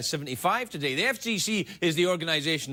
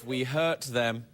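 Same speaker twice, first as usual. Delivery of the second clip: ordinary talk, nothing marked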